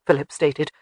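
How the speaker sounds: usual speaking voice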